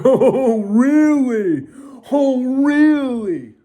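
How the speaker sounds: very high, loud, drawn-out mock laughter in the words; mocking laughing doctor. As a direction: imitate a doctor jeering through laughter. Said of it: "And then he starts laughing".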